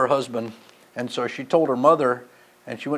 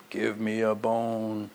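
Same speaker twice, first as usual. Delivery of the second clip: low, slow dog voice; lightly voiced hungry dog begging; 7 semitones lower, 11 dB quieter, much slower than usual. Voicing what the dog thinks.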